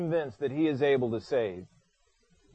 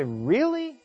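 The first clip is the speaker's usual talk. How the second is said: high, mock disbelief; Jesus turning the question back, disbelieving; incredulous; 4 semitones higher, slower than usual. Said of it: "you'd say".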